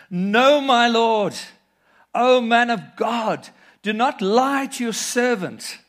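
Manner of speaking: high, loud, slow plea to the prophet; pleading cry. Acted. Woman pleads loudly with the man of God. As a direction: cry out not to be lied to.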